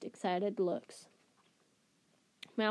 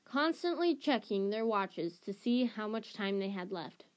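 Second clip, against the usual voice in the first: ordinary delivery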